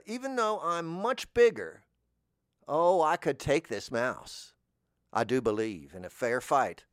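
usual speaking voice

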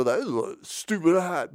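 high, put-on scoffing voice; mocking objector. People fussing at someone's odd hat, mock scorn. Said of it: "'cause they'd go".

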